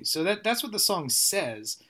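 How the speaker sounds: ordinary delivery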